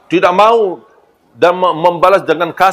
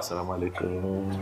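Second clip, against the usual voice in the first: very soft, gentle Assalamualaikum; a hushed, kindly "Assalamualaikum"; soft gentle greeting; 8 semitones lower, 19 dB quieter than usual. Shown as a demonstration. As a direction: breathe "Assalamualaikum" softly with a smile. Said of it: "Makanya saya rubah".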